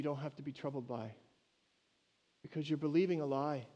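soft, hushed heartbroken master; brokenhearted master. Acted. Master grieving over his servant, softly brokenhearted. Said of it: "I think he's probably brokenhearted".

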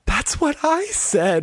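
imitating an actor about to break, high and slow; nearly losing it. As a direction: stretch it out high, as if barely holding it together. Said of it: "you can tell he's kinda losing it".

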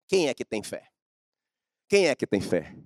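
ordinary talk

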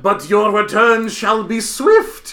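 high, loud Shakespearean proclamation; Romeo and Juliet curse. Acted. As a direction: declaim it slowly to the back row. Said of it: "very much a Romeo and Juliet".

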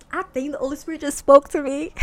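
high, loud delighted exclamation; excited realisation. Real, not acted. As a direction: blurt it out, thrilled and amazed. Delighted realisation about the Holy Spirit.

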